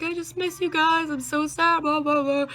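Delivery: high-pitched mock wail, trailing into blah-blahs; imitated sobbing. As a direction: imitate yourself blubbering to your brother. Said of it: "immediately I started sobbing".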